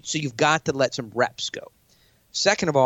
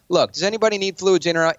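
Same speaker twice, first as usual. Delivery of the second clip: higher and forceful, in command; aggressive opener; 6 semitones higher, 3 dB louder than usual. Shown as a demonstration. Pointing at a billionaire in a pitch meeting, a forceful opener. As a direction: bark the opener to take charge. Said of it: "pointing at a billionaire".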